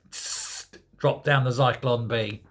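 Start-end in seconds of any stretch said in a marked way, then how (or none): 0.1-0.7 unvoiced hiss, very soft; quiet mock hiss; hissing gas noise. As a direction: imitate gas hissing out of a vent.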